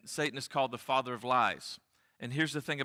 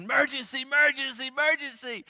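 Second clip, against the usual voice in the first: put-on high-pitched frantic child's yell; screaming little boy; 11 semitones higher, 4 dB louder than usual. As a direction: yell it like a panicked little kid. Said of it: "little Sam comes screaming into the kitchen".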